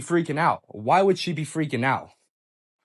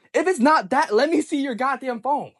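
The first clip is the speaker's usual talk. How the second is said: retold heated insistence, higher and pushed; angry demand; 9 semitones higher, 4 dB louder than usual. Boyfriend demands girlfriend's phone in a quarrel, angry. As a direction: pitch jumps up, pressing hard.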